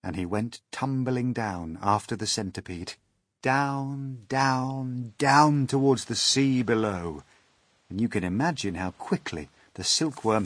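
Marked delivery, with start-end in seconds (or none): none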